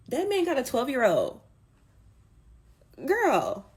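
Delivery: outraged exclaim. Real, not acted.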